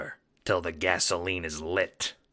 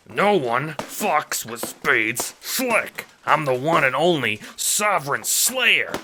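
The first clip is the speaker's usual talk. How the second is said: loud, slow, defiant cry; 8 semitones higher, 6 dB louder, slower than usual. Acted. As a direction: shout it like a cornered thug. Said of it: "you cry".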